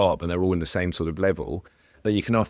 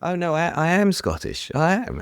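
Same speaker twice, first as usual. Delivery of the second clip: mock insistence; 10 semitones higher than usual. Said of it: "as if, like, he".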